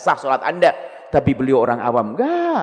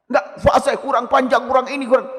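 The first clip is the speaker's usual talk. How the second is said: nitpicking critic; 10 semitones higher than usual. Retold or imitated. Imitating a nitpicking Quran reciter.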